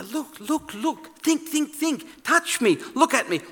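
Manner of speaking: high, insistent pleas to look and touch; urgent pleading. Acted. Jesus urging his frightened friends, pitched high and insistent. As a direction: urge them like a desperate friend. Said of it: "He's trying to say".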